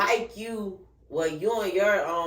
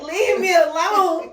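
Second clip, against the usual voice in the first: high, loud, slow laughing wail; crying out; 13 semitones higher, 11 dB louder, slower than usual. Retold or imitated. She tells her ex to leave, high loud laughing wail. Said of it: "I was like".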